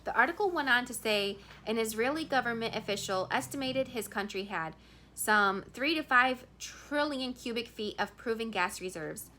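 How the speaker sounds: ordinary delivery, nothing marked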